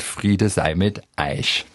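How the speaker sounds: slow Saxon dialect, "euch" turned into "Eich"; drawn-out Saxon "Eich", falling at the end. Shown as a demonstration. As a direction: say "Eich" with a Saxon vowel, rhyming with "Reich". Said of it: "in sächsischem Dialekt gedacht hat".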